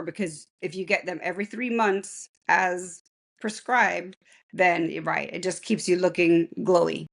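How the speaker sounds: hoarse from bronchitis; rough, raspy recovering voice; gravelly voice. Real, not acted. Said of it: "Sorry for the gravelly voice".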